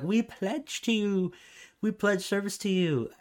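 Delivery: hammed-up servile oath, dragged out; groveling humans. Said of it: "they're like".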